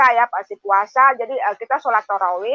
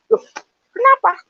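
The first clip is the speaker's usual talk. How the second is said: shocked question; 12 semitones higher, 3 dB louder than usual. Retold or imitated. Shocked question in retold dialogue.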